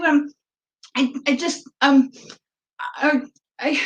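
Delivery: nervous, high stammering about to cry; retold tearful stammer. Fan at a Q and A stammering, near tears. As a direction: choke on every word at the mic. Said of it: "bawling my eyes out".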